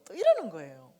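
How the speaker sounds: loud exasperated 이러는 거예요; fed-up loud retort. Shown as a demonstration. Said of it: "그냥 칵 때려주고 싶잖아요".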